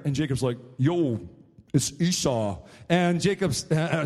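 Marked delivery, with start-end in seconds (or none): 0.8-2.6 lightly disguised, lowered voice; Jacob imitating Esau. Jacob tricking his blind father, lightly disguised voice. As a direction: pretend to be the brother, dropping low at the end. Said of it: "changed his voice a little bit to try and talk like his brother".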